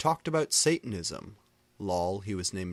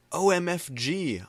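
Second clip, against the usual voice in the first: angry shout; 9 semitones higher, 7 dB louder than usual. A narrator's reading. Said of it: "I shouted arngly".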